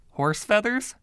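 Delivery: baffled echo. A narrator's reading. Narrator echoes baffled.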